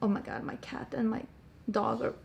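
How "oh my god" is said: ordinary talk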